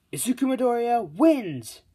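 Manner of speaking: high, loud, held-out announcer call; triumphant arena shout; victory announcement. Acted. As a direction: proclaim the winner to a stadium. Referee announces the winner.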